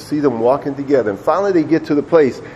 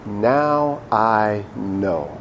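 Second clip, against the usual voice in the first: very slow, soft and weighty; God's solemn verdict; 4 semitones lower, 5 dB quieter, much slower than usual. Acted. God answering Abraham after the test, slow solemn hush. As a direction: draw each word out softly and solemnly.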